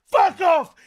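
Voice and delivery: usual speaking voice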